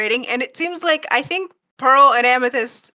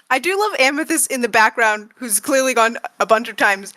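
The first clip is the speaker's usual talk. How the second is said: usual speaking voice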